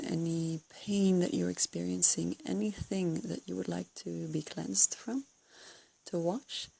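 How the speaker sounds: ordinary delivery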